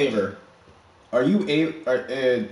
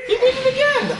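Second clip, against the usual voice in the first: high, loud excited yell; excited shout; 4 dB louder than usual. Real, not acted. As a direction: scream it in disbelief. Hype yell at the repeat move.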